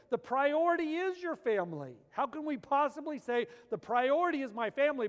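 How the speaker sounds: loud, high preacher's cry; full-force preaching. Acted. Preacher calling out to the men, loud and high. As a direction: preach it out hard to the congregation.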